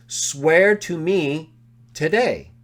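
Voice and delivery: "Swear to me" drawn out slowly, "today" tacked on; slow emphatic read. Shown as a demonstration. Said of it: "Wouldn't that be immediately understood".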